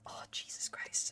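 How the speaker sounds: breathy whispered curse; whispered dismay. Real, not acted. Realising a mistake.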